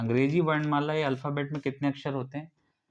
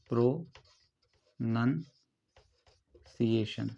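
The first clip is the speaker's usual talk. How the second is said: Pronunciation very slowly in separated chunks, long pauses between; syllable by syllable; 3 semitones lower, much slower than usual. Shown as a demonstration. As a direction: break pronunciation up as if dictating. Teacher breaks the word into parts.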